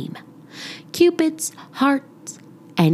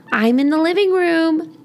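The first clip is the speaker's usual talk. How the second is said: mother calling back; 9 semitones higher than usual. Acted. Mother answers from another room.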